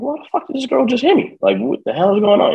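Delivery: very high, baffled why did she hit me; stunned disbelief. Retold or imitated. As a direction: squeal the question in shock. Just got hit, confused.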